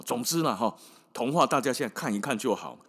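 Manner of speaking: usual speaking voice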